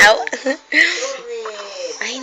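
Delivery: ordinary delivery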